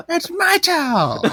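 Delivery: very high, drawn-out wail; hammed-up squeal of indignation; mock horrified outcry. Wife discovering her husband swapped the towels, mock-horrified.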